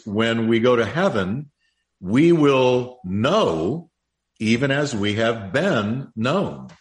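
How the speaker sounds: drawn out, weighty scripture line; slow each word for weight; slow emphatic quote. Real, not acted. Preacher slows quoted scripture.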